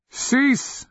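high, forceful cry of command. A narrator's reading. Wizard commanding the chained shadows, crying out. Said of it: "Akamian cried".

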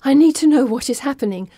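breathless and urgent; panting, pressing for answers; out of breath. Acted. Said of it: "a little out of breath".

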